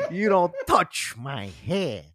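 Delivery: slow, drawn-out gangster threat; slow mock mob warning; mock tough-guy line. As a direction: lean on it like a wiseguy. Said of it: "repeats the quote".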